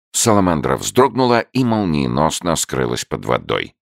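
ordinary delivery, nothing marked

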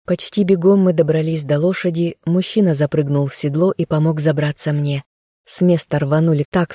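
plain reading voice

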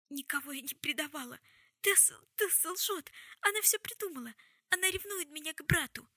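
high, loud, hysterical protest; frantic denial. Acted. Jessamine accusing Tessa to save herself, loud and frantic. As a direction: cry out accusations in desperate self-defence.

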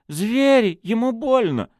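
high, loud, distressed protest; agitated outcry. A narrator's reading. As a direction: cry out upset on his behalf. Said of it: "заволновалась Мэгги". (возмущённый, взволнованный крик)

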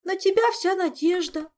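high, loud sobbing plea; tearful Zin. Acted. Zin pinning all hope on her friend, tearful high plea. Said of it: "всхлипнула Зинь".

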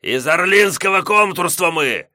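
high, loud furious bark; barking roar. Acted. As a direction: bark it in fury. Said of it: "в сердцах рявкнул Гос".

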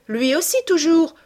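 high, loud fervent cry; ringing, impassioned exclamation; passionate insistence. A narrator's reading. Continues her passionate cry of love.

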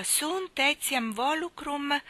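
waiting for the next words to come; very slow. A narrator's reading. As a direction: stretch each Latin word out. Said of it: "disse Tom molto adagio".

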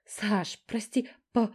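high, loud breathless wailing apology; chirping lament. A narrator's reading. Younger sister apologising to everyone, chirping lament. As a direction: babble your apologies. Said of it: "зачирикала, запричитала Стежка".

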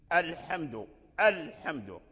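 الحمد begun on a clear glottal al; sounded hamza. Shown as a demonstration. Teacher restarting after the stop, الحمد with sounded hamza. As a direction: start الحمد freshly with a full a. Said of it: "وأحيانًا تثبت".

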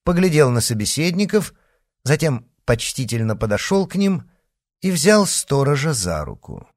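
plain reading voice

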